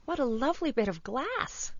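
high, put-on girl's voice; delighted little girl. A narrator's reading. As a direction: exclaim in delight as a small child. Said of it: "cried the little girl".

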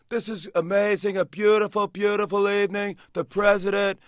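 high, loud, pompous Trump voice; boastful mock-presidential Trump voice; Donald Trump impression. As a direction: puff it up like Trump at a press conference. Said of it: "I did a Donald Trump impression".

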